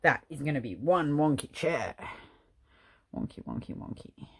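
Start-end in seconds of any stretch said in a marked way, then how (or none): 3.2-4.3 whispered. Real, not acted. Muttering at crooked chair.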